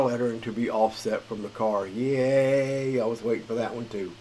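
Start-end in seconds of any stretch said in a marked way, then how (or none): 1.9-3.0 drawn-out, raised little cheer; happy cheer. Real, not acted. Gamer welcoming a long-awaited lettering fix, cheering. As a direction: celebrate it with a long yay.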